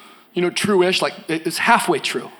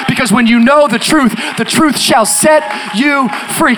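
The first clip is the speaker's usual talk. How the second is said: much louder, shouting, building sermon climax; full-force preaching; 3 semitones higher, 9 dB louder than usual. Acted. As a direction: bring the church to its feet.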